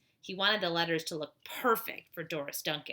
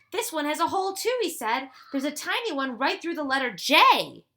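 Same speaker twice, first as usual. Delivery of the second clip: loud, higher, eager announcing; excited discovery; 7 semitones higher, 9 dB louder than usual. Acted. Ruth Rose to the boys about pinholes, excited. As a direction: share the find with rising excitement. Josh finds another hole.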